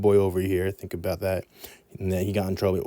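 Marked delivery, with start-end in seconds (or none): none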